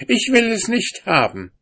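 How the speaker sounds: sehr hoch und laut, angeekelt; empört rufen; angewiderte Frau. A narrator's reading. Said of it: "rief ihm die Frau entgegen". (Frau ruft dem Mann an der Tür entgegen)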